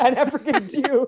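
cracking up as you say it; laughing. Real, not acted. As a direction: repeat it high through real laughter. Repeating a funny line.

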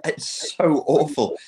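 high laughing words, slowing at the end; real giggling disbelief. Real, not acted. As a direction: laugh your way through it. Cracking up at the clip.

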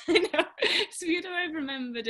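laughing. Real, not acted. Laughs at her own accent.

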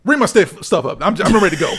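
mock-angry demand breaking into laughter; laughing retold demand. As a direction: replay your impatient demand while cracking up. Retells snapping at cashier, laughing.

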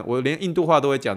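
ordinary talk, nothing marked

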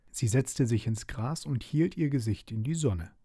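ordinary delivery

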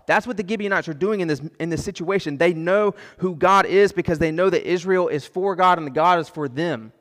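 ordinary talk

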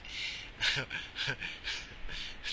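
breathy chuckle at oneself; real laughter. Real, not acted. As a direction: laugh softly at your own blunder. Laughs at own blunder.

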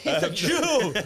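laughing loudly. Real, not acted. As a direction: blurt it out through laughter. Joke lands, laughter.

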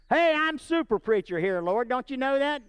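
hammed-up bragging to the Lord; mock boast. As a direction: swagger like a self-important preacher.

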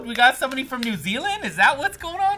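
high, excited question; thrilled surprise at a new follower. Real, not acted.

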